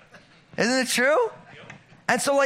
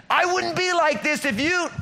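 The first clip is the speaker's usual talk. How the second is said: blaming outburst; 9 semitones higher than usual. Retold or imitated.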